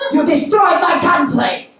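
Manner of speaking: high, loud acted accusation; mock accusation. Cab driver blames British passenger, mock outraged and loud. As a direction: hurl the blame, hammed-up.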